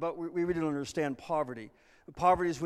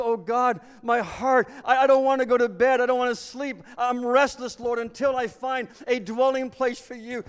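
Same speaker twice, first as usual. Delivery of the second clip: restless plea; 8 semitones higher, 7 dB louder than usual. Acted. David's restless plea voiced.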